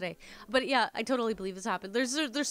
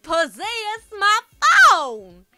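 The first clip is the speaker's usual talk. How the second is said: put-on loud, slow Alabama accent, almost a scream; panicked Southern customer screaming down the phone; near-screaming Southern drawl; 7 semitones higher, 12 dB louder, much slower than usual. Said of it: "almost screaming".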